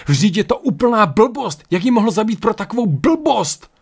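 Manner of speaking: furious. A narrator's reading. Ryan rages at the senseless killing, furiously. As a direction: explode in outraged disbelief. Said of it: "zuřil Ryan".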